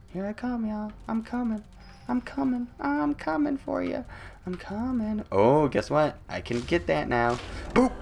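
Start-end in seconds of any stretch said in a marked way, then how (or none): 0.1-5.2 high, soft, teasing call; creepy sing-song taunt. Acted. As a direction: taunt them like a lurking monster.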